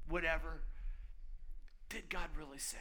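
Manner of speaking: hushed, voiceless whisper of doubt; tempter's whisper. Acted. Preacher voicing Satan's whisper to the crowd, hushed. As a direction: breathe the question like a serpent.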